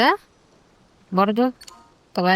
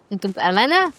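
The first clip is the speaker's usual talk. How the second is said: ordinary talk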